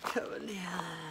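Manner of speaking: high, soft, drawn-out name; crazed attacker imitation. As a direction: stretch the name like a deranged grudge. Imitates crazed attacker's only words.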